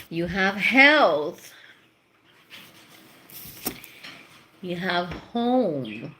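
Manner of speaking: slow, measured tarot reading with long pauses; slow card reading. Acted. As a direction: lay out each card slowly and deliberately. Reading out the cards.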